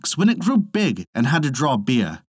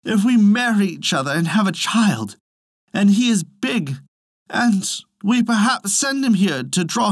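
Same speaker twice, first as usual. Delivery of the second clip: higher, slowing wail of worry; tearful lament; 8 semitones higher than usual. A narrator's reading. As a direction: Elsie pleading with Hans, mildly tearful. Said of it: "said Elsie".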